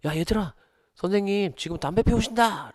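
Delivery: high-pitched, loud calling out; acted breaking news. Class president rushing to tell classmates about the teacher, urgent cry. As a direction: imitate a student urgently alerting the whole class. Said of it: "헐레벌떡 다가오더니".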